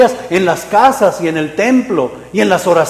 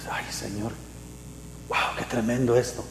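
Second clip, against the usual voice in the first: much softer, almost breathless wonder; a hushed, amazed aside to the Lord; hushed awe; 6 semitones lower, 14 dB quieter than usual. Retold or imitated. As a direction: marvel at it under your breath. Believer amazed by revelation.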